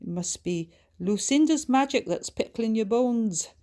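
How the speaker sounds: put-on spirited chat with an old friend; lightly voiced story character. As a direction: lift the voice into lively, teasing dialogue.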